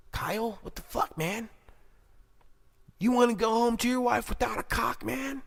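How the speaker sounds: high-pitched, incredulous protest; pleading buddy. Acted. Scared would-be shooter warning Kyle, high-pitched protest.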